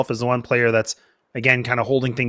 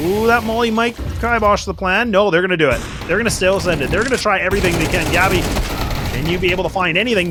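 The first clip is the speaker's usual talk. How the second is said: rising hype, much higher and faster as the rush lands; excited casting; 6 semitones higher than usual. Real, not acted. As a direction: call the rush like it's the play of the match. Caster hyped by a B rush.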